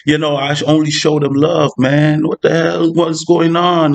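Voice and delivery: hammed-up heartbroken venting; mock hurt lament. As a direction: play the wronged friend, voice rising in disbelief. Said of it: "you're crying, and you're like".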